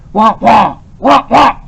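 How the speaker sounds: loud mock barking of a big angry dog. A narrator's reading. Professor imitating a big dog for his puppy, loud mock barks. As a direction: bark like a large angry dog, lightly done. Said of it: "in the dark, growling voice of a large, angry dog".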